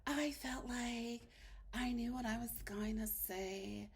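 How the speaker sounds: soft, slow mock confused wife; hushed, drawn-out imitation of her confusion; imitating tearful confessional. Mimicking Paige in her confessional, soft mock confusion. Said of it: "because she's in her confessional".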